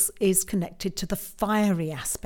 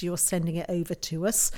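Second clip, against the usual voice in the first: ordinary delivery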